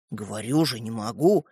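low, quiet refusal; reluctant. Acted. Стёпа refusing to hand over the toy, reluctant.

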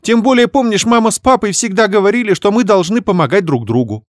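high, loud wheedling sister; fawning. Acted. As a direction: sweet-talk her to soften the blow. Sister keeps pleading. (лебезя, высоким голосом)

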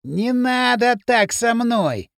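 put-on high, drawn-out drunken cooing; sugary warning. A narrator's reading. Drunk Пакля warns Кирилл, tipsily sweet. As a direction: warn him slowly, with mock affection. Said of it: "ласково предостерёг Пакля".

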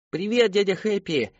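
high-pitched gleeful chorus cry; mock kids' shouted greeting; children shouting back. A narrator's reading. Children answer the clown at a party, shouting. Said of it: "прокричали в ответ дети".

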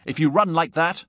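loud, high-pitched urgency; cried warning. A narrator's reading. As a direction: shout a warning after a runner. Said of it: "I cried".

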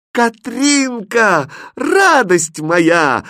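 high, drawn-out joyful cry; elated, slow, ringing shout; delighted cry. A narrator's reading. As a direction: call out in rapture, stretching each word. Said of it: "в восторге закричал Хрустальт".

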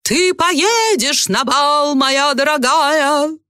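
high, very slow fairy godmother; grand magical pronouncement; resolute fairy godmother. A narrator's reading. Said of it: "решительно произнесла".

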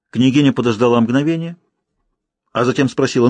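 plain reading voice

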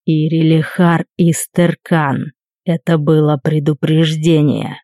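slow, quiet and ominous; low threat, quiet and slow; quiet menacing voice. A narrator's reading. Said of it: "Тихим, но от чего-то внушительным".

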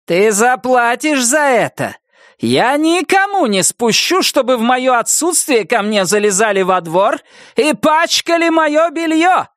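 high, hammering, slowed threats; raging threats. Acted. Wilma threatening Nettie on the phone, raging. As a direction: pound every threat home.

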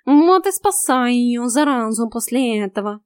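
loud, slow, grumbling cat; cat complaining after being kicked; grumpy cat voice. A narrator's reading. Cat Vaska voice.

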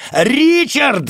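high, loud desperate yell. Acted. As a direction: scream his name in panic. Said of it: "заорал я". (отчаянный крик)

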